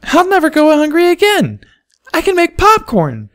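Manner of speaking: put-on very high, loud little girl shout; high squealing triumph; triumphant filly. A narrator's reading. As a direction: cheer it like a thrilled child.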